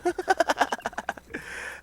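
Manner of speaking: breathless, voiceless wheeze of a laugh; wheezy laugh. Real, not acted. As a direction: wheeze at the joke. Laughs at the diss.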